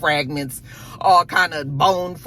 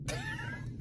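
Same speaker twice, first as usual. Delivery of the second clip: real high giggle, trailing off soft; high laugh; 17 dB quieter than usual. Real, not acted. Recapper laughing at her own joke, high giggle. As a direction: burst into a thin high giggle.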